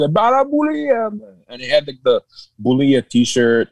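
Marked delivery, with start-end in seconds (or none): 0.1-1.4 lightly hammed-up high cheer; high-pitched catchphrase call; mock hailing. Acting out his reply in character.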